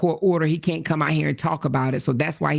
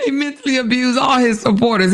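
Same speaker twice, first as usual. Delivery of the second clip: emphatic raised voice; loud rant; 6 semitones higher, 7 dB louder than usual. Real, not acted. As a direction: hammer it home loudly. Ranting about him, loud.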